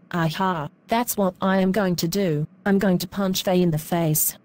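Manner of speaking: normal talking voice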